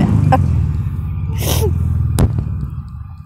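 a long airy laugh she can't hold back; breathy stifled laugh. Real, not acted. Laughing at the old man's fart story, real stifled giggling. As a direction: let the giggle leak out in silent breathy bursts. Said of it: "trying really hard not to laugh hysterically".

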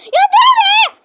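high, loud shout. Real, not acted. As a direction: yell at her to stop. Stopping sibling.

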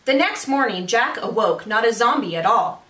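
ordinary delivery, nothing marked

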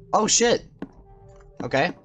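ordinary delivery

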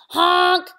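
loud, high, drawn-out honk; scared truck honk. A narrator's reading. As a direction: blare it like a stuck truck calling for help. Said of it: "cried the dump, and he sounded scared".

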